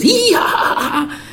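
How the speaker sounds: high-pitched mock laugh; exaggerated high hee-ha giggle; imitated giddy laugh. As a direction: act out laughing at everything. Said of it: "Everything was funny".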